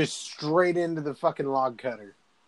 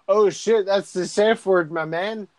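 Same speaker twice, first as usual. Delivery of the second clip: raised, louder retold alarm; mock alarm; 6 semitones higher, 6 dB louder than usual. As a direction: play a sudden realization, lightly hammed up. Retold alarm at hearing safe word.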